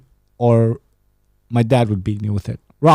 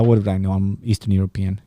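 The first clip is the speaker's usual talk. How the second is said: normal talking voice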